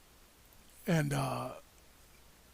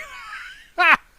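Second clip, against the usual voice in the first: loud laugh; 13 semitones higher, 9 dB louder than usual. Real, not acted. Laughs about groin tasing death.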